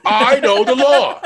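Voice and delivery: high, loud, slow mock yell; hammed-up movie tirade. Friend joking in a shouting movie-star voice. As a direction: ham up a courtroom outburst. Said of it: "movie quote riff".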